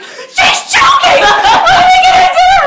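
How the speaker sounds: high, loud, slow frantic shriek; mock panicked sister screaming, laughing. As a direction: shriek it at her face, laughing through the panic. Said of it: "she just screams in my face".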